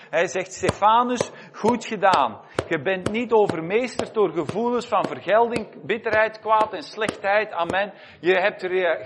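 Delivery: fiery pulpit preaching building up; preaching at full force. Acted. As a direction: drive the message home to the congregation.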